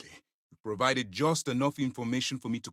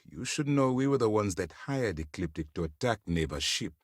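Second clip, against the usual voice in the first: ordinary delivery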